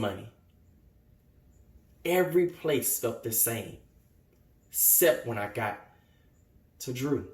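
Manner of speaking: low, soft, halting confession; quiet hurt. Acted. Young man remembering his refuge at Drew's, quiet hurt.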